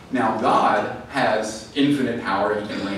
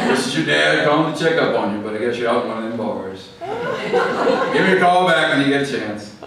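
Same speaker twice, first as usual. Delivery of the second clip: imitated Southern dad leaving a voicemail; loud, folksy Southern father voice; Southern accent; 3 semitones higher, 5 dB louder than usual. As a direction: play a Southern dad checking up by phone. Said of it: "have that kind of Southern accent".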